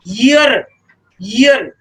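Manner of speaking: ईयर said with a clear y glide at the start; sounding out year. Shown as a demonstration. Teacher to class, correcting ईयर with a clear yee start. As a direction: stress the yee opening of ईयर. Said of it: "लेकिन ये क्या होता है".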